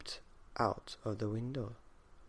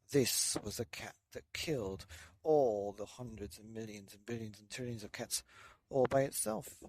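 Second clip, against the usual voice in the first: ordinary delivery, nothing marked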